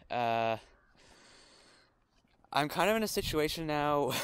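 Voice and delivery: normal talking voice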